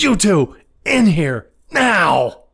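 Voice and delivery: high, punched summons; mock-stern high command, word by word; imitated angry boss. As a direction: order them in like a furious boss. Acts boss summoning two employees.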